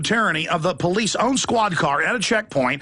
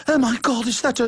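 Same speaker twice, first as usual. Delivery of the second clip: higher, hammed-up dismay; mock tearful horror; 4 semitones higher than usual. Imitating British woman seeing something, mock tearful horror. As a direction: imitate someone shocked to tears. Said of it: "it was tears".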